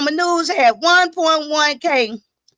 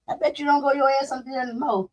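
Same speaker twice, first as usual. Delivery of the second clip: ordinary delivery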